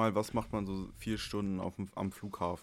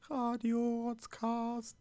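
high, slow radio jingle voice; radio announcer; much slower than usual. Acted. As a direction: announce it like a cheesy radio station. Gespielte Radio-Ansage. (hohe, langsame Radiosprecher-Stimme)

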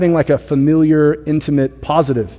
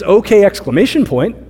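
higher, lively "okay" with exclamation; easygoing okay, pitched up; no-problem okay; 6 semitones higher, 3 dB louder than usual. Shown as a demonstration. Preacher demonstrating a spouse's agreeable text, higher and lively. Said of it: "then it means no problem".

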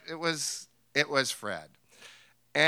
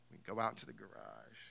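ordinary talk

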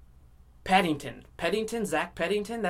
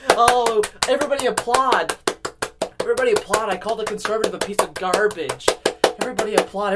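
loud, high call for applause; hammed-up shouted boast; mock self-congratulation; 5 semitones higher, 8 dB louder than usual. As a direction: holler to the crowd, milking it. Demands applause for his own insult.

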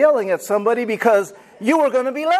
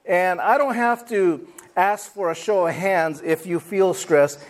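ordinary talk

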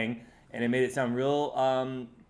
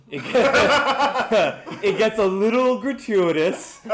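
higher and louder, laughing through words; 7 semitones higher, 8 dB louder, much slower than usual. Real, not acted. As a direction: crack up mid-sentence. Laughing about friend's eating.

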